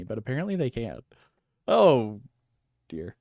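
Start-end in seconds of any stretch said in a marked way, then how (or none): 1.7-3.1 loud startled cry of fright; scared horror game streamer. Real, not acted. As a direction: blurt it out after a jump scare. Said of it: "these guys could jump scare me".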